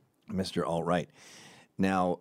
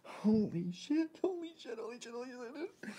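much higher, quiet squeaky gasps of panic; mock panic; 14 semitones higher, 10 dB quieter than usual. Hillary freaking out alone, high squeaky panic. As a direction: squeak it out, losing control. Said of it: "she went".

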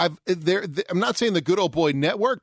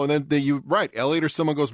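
usual speaking voice

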